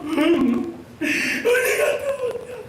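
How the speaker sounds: put-on half crying, half scolding the found child; mother hugging her son, crying loudly; relieved mother scolding. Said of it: "그 엄마는 또 왜 이러면서".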